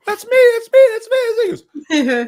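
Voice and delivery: high, loud, starstruck yelping; excited mock squeal. Musician retelling his reply to his idol, excited high squeal. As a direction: squeal it like an overeager fan.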